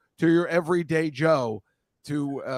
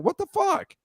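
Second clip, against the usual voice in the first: much higher squeaky mock-baffled cry; squeaky animal voice. Host capping the animal trilogy, high squeaky outrage. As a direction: squeak it out like a stunned critter. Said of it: "a trilogy of animals saying".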